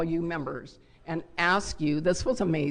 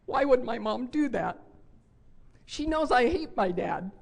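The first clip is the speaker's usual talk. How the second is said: put-on higher, upset little boy; child's voice; 6 semitones higher than usual. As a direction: lightly voice the hurt grandson. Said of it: "With my grandson saying".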